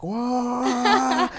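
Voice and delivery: high, loud, drawn-out mimicked squeal; shocked shriek. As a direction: squeal it like a stunned sister. Sister's shocked reaction retold.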